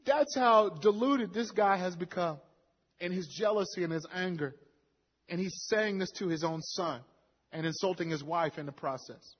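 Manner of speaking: ordinary talk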